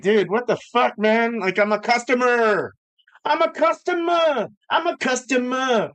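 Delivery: building from indignant to a high shouted I'm a customer; retold outburst. Telling the clerk off, rising to a shout.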